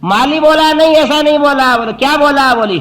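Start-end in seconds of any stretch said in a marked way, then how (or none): 0.7-1.8 much higher, louder denial; gardener's objection. Acted. Vegetable seller contradicting the wrestler, high loud denial. 1.9-2.5 much higher, louder denial; gardener's objection. Acted. Vegetable seller contradicting the wrestler, high loud denial.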